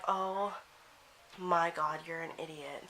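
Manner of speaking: low, soft, fading aside; muttered dismay. Retold or imitated. Re-enacting watching her friend embarrass her, low appalled muttering. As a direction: mutter it under your breath, appalled.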